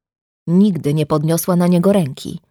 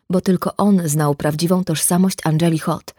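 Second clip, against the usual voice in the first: plain reading voice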